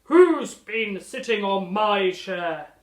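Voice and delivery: slow, very loud booming bear voice; Daddy Bear bellowing. Acted. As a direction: boom it out like a big father bear. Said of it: "he bellowed".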